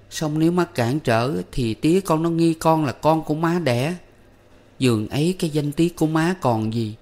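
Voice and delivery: mother explaining her misery to her son, sorrowful; forcing herself to speak. A narrator's reading. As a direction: explain gently while holding back grief. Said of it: "gắng gượng mà nói".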